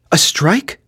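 high, rising startled question; alarmed cry. A narrator's reading. Molly hears of the strike from her husband, alarmed cry. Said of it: "cried Molly, clasping her hands together".

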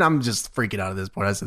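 ordinary delivery

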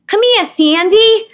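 put-on high, loud, slow kid's Brooklyn accent. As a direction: belt it like a Brooklyn kid playing Annie. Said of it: "I had a very strong Brooklyn accent".

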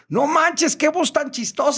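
very high, delighted outburst; amused listeners' exclamation. Retold or imitated. Radio listeners react to the funny voice, high amused exclamation.

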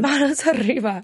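rolled Spanish cop line; mock Spanish accent. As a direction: bark it like a TV cop.

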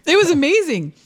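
high, gushing delight. Real, not acted. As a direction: swoon over the memory. Gushing about celebrity encounter.

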